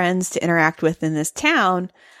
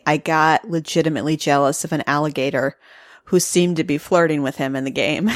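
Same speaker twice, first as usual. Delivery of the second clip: ordinary talk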